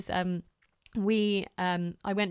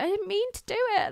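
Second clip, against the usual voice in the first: put-on much higher, quick, pleading child voice; imitating little son; 12 semitones higher, faster than usual. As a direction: play a six-year-old caught out, protesting. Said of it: "poor Grayson was just stood there like".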